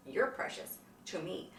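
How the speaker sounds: soft whispered affection. Real, not acted. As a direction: breathe it tenderly. Tender words to friend.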